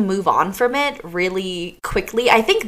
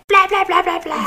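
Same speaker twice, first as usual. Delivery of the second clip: mock ranting; 11 semitones higher than usual. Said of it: "calling my parents after and just being like".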